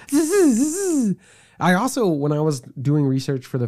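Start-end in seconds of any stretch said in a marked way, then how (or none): 0.1-1.2 teleport buzz. Retold or imitated. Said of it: "You just be like".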